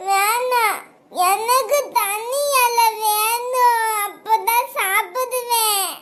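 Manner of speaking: high, very slow sobbing demand; crying tantrum. Acted. Child refusing mother's feeding, sobbing tantrum. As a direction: whine through tears for your own leaf.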